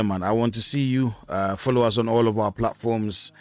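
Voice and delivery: ordinary talk, nothing marked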